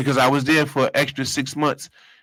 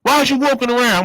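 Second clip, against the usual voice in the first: high, loud sergeant challenge; barking sergeant; 13 semitones higher, 4 dB louder than usual. Retold or imitated. Sergeant catching him walking during count, loud. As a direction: stop the inmate in the hall. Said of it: "the sergeant".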